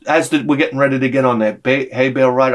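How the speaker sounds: ordinary talk, nothing marked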